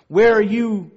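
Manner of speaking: loud, high, imitated demand; crying out at God. Hurting believer calls to God, crying out. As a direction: call out for an absent God. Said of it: "she's crying out".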